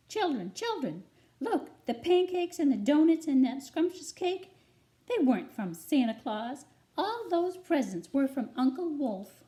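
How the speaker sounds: high, loud mother hen calling her chicks; excited hen. Acted. Mother hen calls her chicks to see the gifts, high and loud. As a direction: call the children over in delight.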